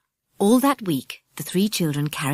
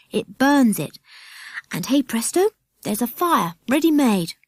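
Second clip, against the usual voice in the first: put-on eager boy; 6 semitones higher, 3 dB louder than usual. A narrator's reading. Jack shows magnifying glass.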